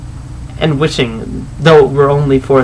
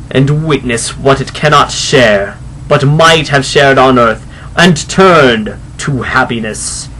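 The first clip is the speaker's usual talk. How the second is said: slow, loud, booming spectral pronouncement; solemn ghost; 4 dB louder than usual. Acted. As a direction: intone it like a phantom from beyond. Ghost explains its doom.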